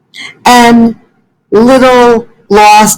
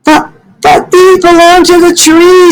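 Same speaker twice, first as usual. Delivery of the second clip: much higher, stammering protest; upset Fletcher protesting; 9 semitones higher than usual. Acted. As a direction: plead that the leaves are the tree's. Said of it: "said Fletcher".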